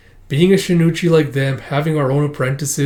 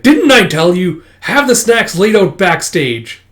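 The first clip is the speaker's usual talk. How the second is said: louder, slow and pointed reprimand; mock scolding; 5 semitones higher, 6 dB louder, slower than usual. Master to pupils about the snacks, mock scolding. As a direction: draw out the telling-off in a raised voice.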